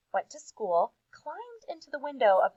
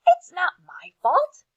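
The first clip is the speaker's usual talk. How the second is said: high, slow, loud protest; exasperated principal shouting; principal yelling; 9 semitones higher, 9 dB louder, slower than usual. A narrator's reading. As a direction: drag out the defence at full voice. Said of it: "yelled the principal".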